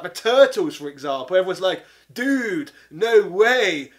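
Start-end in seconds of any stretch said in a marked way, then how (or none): 2.1-3.9 loud, mocking California surfer drawl, pitched up; mock surfer dude accent. Mocking cartoon Turtles, surfer accent, louder. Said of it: "talk in this really annoying accent".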